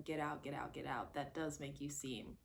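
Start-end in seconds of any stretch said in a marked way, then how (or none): none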